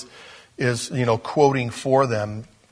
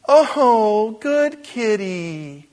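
high, slow, cooing praise for a pet; praising the kitty; 8 semitones higher, 5 dB louder, much slower than usual. Pretend. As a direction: coo it like you're praising a cat. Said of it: "And you say".

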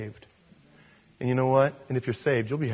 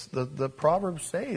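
ordinary talk, nothing marked

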